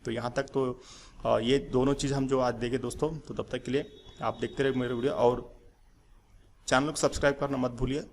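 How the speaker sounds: ordinary talk, nothing marked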